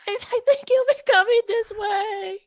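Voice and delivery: very high, loud little creature voice; high-pitched kobold informant; frightened kobold. Acted. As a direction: squeal the information out in a panic. GM voices kobold answering questions.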